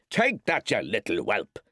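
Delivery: high burst dropping to a snarl; raging father beating the boy; vicious. A narrator's reading. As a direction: spit it out with the blow. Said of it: "striking at him viciously with the stick".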